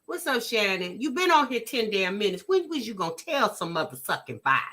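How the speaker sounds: normal talking voice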